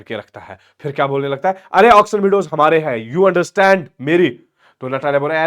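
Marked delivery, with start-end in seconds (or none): 1.7-4.3 louder, slowed insistence; insistent outburst. Retold or imitated. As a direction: drag out the claim, pressing hard. Lomov clutching heart, insisting.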